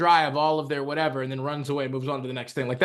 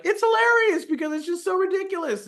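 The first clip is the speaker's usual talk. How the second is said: high mocking imitation of people who joke about it; 13 semitones higher than usual. Reacting to Reddit post, mocking clueless people in a high voice. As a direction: mimic them, pitched way up and far from your own voice. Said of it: "they're just like".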